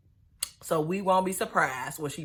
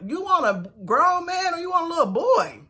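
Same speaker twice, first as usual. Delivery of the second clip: incredulous; 10 semitones higher, 5 dB louder than usual. Real, not acted. Scolding Angela, incredulous.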